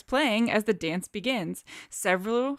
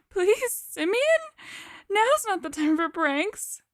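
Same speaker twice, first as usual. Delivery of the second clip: trembling voice; 11 semitones higher, slower than usual. Acted. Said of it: "asks in a trembling voice".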